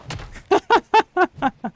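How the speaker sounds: high, loud burst of laughter; real laughing fit. Real, not acted. Streamer cracking up at a really funny hit, laughing hard.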